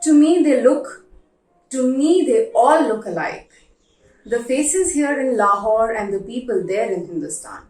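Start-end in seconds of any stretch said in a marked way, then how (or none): none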